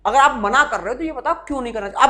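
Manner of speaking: ordinary talk, nothing marked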